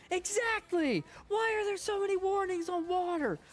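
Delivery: high, loud ranting comic impression; Orny Adams rant. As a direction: yell it like a fed-up comic on stage. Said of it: "It's like".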